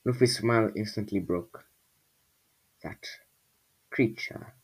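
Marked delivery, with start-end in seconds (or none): 2.8-4.5 soft unvoiced murmur. A narrator's reading. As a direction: breathe the words out barely audible. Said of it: "Lufri murmured".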